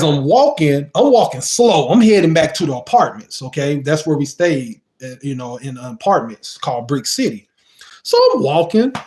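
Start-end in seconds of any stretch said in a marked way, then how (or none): none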